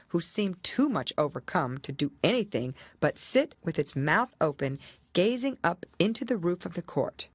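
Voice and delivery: plain reading voice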